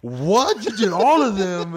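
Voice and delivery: high, loud disbelief; shocked bro. Retold or imitated. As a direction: play the stunned shop guy. Shocked shop guy quoted.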